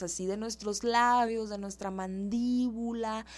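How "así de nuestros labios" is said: ordinary talk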